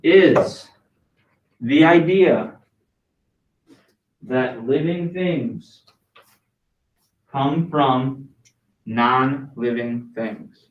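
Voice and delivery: slow, chunked dictation with long pauses. Shown as a demonstration. Teacher dictating the answer to class, slowly. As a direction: pace it for note-taking. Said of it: "I'll say it again".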